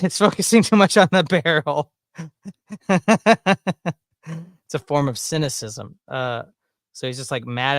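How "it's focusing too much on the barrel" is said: loud, higher-pitched laughing speech. Real, not acted. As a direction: crack up while talking. Cracks up at the barrel talk.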